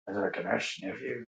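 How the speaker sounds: put-on a very hushed old-man voice, almost gone; creepier Biden whisper. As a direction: push the whisper into something creepy. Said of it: "make that voice a little more creepy".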